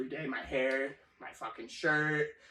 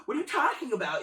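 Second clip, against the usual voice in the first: exaggerated, laughing outrage; retold indignant protest; 10 semitones higher, 5 dB louder than usual. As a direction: throw the complaint back in loud mock disbelief. Retells indignant comeback to boss.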